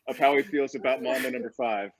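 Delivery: laughing as you retell the teasing. Real, not acted. Teased speaker voices co-host's jibe, laughing. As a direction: talk through laughter.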